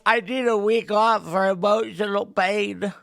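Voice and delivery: slow, put-on whiny loser; mocking whiner, slow, in a put-on voice. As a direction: drag it out like a sulking complainer. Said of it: "whiny losers who's gonna be like".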